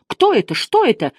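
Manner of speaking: usual speaking voice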